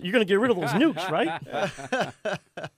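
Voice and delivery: high, loud hearty voice, laughing through; jolly captain coaxing, fast; Skipper voice. Retold or imitated. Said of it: "he looked like the skipper".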